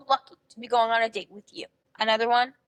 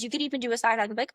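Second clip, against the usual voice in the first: usual speaking voice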